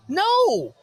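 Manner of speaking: high, loud furious yell of refusal; angry shout. Retold or imitated. Podcaster replaying his reply to YouTube, shouting in outrage. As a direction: blow up, yelling the answer back.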